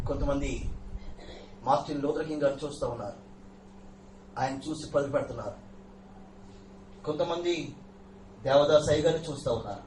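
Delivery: ordinary talk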